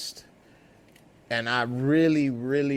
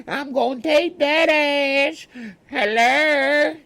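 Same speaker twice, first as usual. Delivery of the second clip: Madea rant; 9 dB louder than usual. Retold or imitated. Imitating Madea threatening and calling for the police, falsetto howl. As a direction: howl it like a crazed granny. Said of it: "Who wants some of that".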